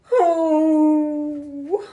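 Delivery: high, loud wounded wail; loud mock howling sob; howl like a wounded dog. A narrator's reading. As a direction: howl out in grief. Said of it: "let out a howl like a wounded dog".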